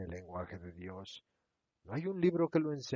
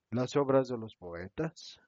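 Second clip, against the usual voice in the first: ordinary delivery, nothing marked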